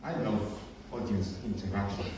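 quiet aside. Real, not acted. Out-of-character aside.

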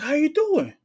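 high, loud, in-your-face "How you doing?"; brash Brooklyn hello; loud greeting. Retold or imitated. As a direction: bark the greeting like a New Yorker. New Yorker greeting a neighbor.

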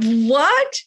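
very high, rising retold "what"; incredulous. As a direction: imitate yourself utterly baffled. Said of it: "I'm like".

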